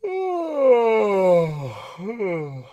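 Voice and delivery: big sighing yawn with tongue pulled back; a sloppy amateur yawn. Shown as a demonstration. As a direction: yawn it out loosely. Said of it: "you notice my tongue did this".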